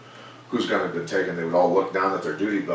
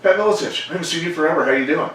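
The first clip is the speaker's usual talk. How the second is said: suddenly much higher, louder, bright hello; dazed cheery greeting; 10 semitones higher, 4 dB louder, faster than usual. Retold or imitated. Concussed fighter greeting his coach after the fight, high cheery surprise. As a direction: greet an old friend out of nowhere, pitched way up. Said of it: "then he looks up at me and goes".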